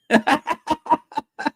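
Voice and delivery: a real laugh, pitched high; high amused laugh. Real, not acted. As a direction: laugh at the silly place name. Laughs at Tatooine joke.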